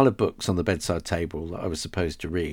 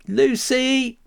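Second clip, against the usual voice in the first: high frightened call across the house; 4 dB louder than usual. Acted. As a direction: shout for your sister. Boy calls sister in fear.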